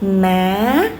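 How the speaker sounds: "ma" swept upward at the end; rising tone. Shown as a demonstration. Teacher practising the second tone, rising "ma". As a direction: raise "ma" as if asking. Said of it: "to practice pronouncing".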